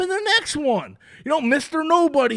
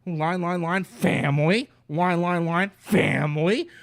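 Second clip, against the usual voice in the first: much lower, deep mock chant of family; a parody of the tough-guy family speech; mock Dom voice; 6 semitones lower, 3 dB quieter than usual. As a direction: ham up a gruff Dom droning on about family. Mocking the franchise's family theme.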